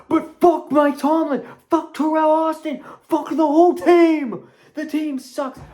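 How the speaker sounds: loud furious fan rant; angry. Real, not acted. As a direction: spit it out at the team.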